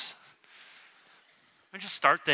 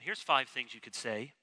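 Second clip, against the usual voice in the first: normal talking voice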